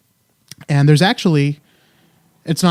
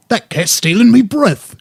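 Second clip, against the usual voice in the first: high, slow hammed-up Cockney; Cockney accent; 8 semitones higher, 5 dB louder, slower than usual. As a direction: say it like a London Cockney. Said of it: "I could do, like, a Cockney".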